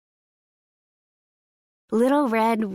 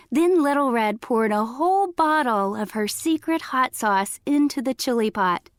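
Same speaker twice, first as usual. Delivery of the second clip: plain reading voice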